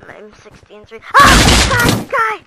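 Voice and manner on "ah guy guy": very high, loud scream; high yell of alarm; panicked shriek. Real, not acted. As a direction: shriek at a sudden enemy. Enemy appears while reloading.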